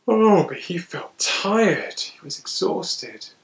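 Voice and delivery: slow, drawn-out tiredness; a touch of yawning weariness; weary. A narrator's reading. Narrator acts the boy's tiredness.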